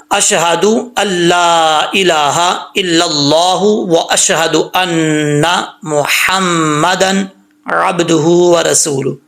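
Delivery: slow recitation with held vowels and nasal hums; tajweed recitation. Shown as a demonstration. Teacher models the next line, measured recitation. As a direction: recite deliberately, every letter distinct.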